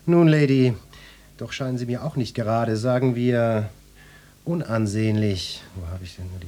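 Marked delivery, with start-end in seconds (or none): 0.1-0.7 louder, higher opening to the lady; emphatic address. Acted. Inspector turning on the lady, emphatic. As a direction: make her listen, voice lifted.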